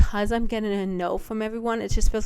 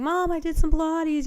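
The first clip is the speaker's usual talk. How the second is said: higher, eager repeat of the news; bursting to tell mom again; excited to mom; 8 semitones higher than usual. Retold or imitated. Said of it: "called her up ... nonstop saying".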